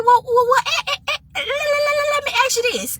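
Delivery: high, stuttering interruption; flustered stammer. Retold or imitated. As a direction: trip over your words trying to ask. Flustered, stammering follow-up question.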